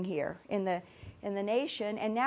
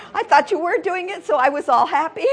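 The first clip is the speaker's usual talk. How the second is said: loud, higher-pitched laughing talk; 6 semitones higher, 9 dB louder than usual. Real, not acted. Teacher teases a student about the task, laughing. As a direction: talk through a big laugh.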